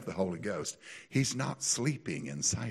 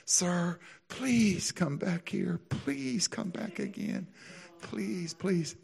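pleading waiter; 9 semitones higher, much slower than usual. Retold or imitated. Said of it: "He goes".

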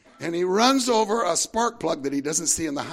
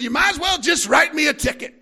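loud, high, fed-up shout; frustrated outburst; 13 semitones higher, 9 dB louder than usual. Retold or imitated. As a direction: throw the words out in exasperation. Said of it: "he was so frustrated".

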